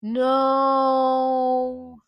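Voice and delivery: one long, high, loud held "no"; dismayed wail. Real, not acted. Fan watching Whitebeard get shot, dismayed wail. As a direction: cry it out and hold it. Dismay as everyone shoots him.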